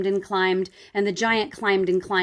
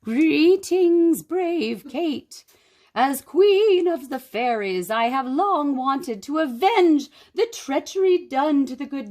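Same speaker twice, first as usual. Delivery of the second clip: high, regal fairy queen; 4 semitones higher, 4 dB louder than usual. Acted. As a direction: speak grandly as a fairy queen.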